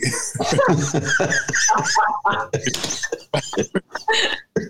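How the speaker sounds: long high laughing fit; helpless, drawn-out laugh; real burst of laughter. Real, not acted. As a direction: crack up at your own story. Laughs at his own story.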